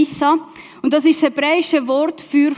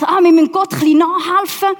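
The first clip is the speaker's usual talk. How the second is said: scheming plan; 4 semitones higher, 4 dB louder, faster than usual. Retold or imitated. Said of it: "Sie haben gedacht".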